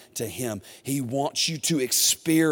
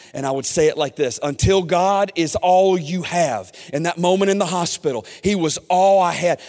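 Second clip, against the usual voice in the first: loud, higher, driving preacher voice; preaching at full force; 6 semitones higher, 8 dB louder than usual. Acted. Pastor stirring up the congregation, loud and driving. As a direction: fire up the congregation.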